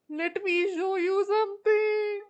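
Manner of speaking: teasing build-up, belted out high; playful high shout. Real, not acted. Streamer teasing a friend before showing off, playful high shout.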